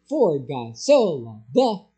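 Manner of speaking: slow, punched "For", "so", "the", with "God" and "loved" dropped; wrong emphasis. Shown as a demonstration. Pastor mocking unnatural talk, slow stress on "For", "so", "the". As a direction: stress the little words "For", "so", "the". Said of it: "We don't talk that way".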